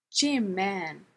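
mildly protesting to a friend; a touch of protest. A narrator's reading. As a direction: object gently, wanting no fuss. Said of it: "Blair protested".

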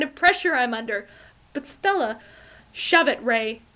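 loud, high sneering rant. A narrator's reading. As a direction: sneer it at him, loudly. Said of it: "now she's sneering at him".

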